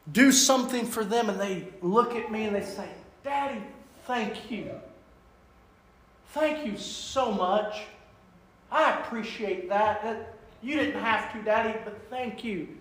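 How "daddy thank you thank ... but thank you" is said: put-on hushed, tender little-kid thank you; soft child's thanks. Child softly thanking daddy, quiet and tender.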